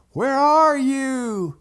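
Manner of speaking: loud, high, drawn-out holler; calling out. Retold or imitated. Daddy searches for his boys, hollering. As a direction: stretch the words to carry far.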